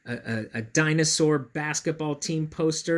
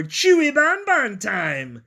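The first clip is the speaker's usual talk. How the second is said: high, loud, slow hungry monster shout; hungry dinosaur battle shout; dinosaur yell; 9 semitones higher, 8 dB louder, slower than usual. A narrator's reading. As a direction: shout it as greedy charging dinosaurs. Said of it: "a trio of dinosaurs yelling".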